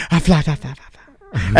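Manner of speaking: rapid fla-fla-fla that drops in pitch as it peters out; imitated flapping noise. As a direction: make a silly flapping sound effect. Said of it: "the penis goes".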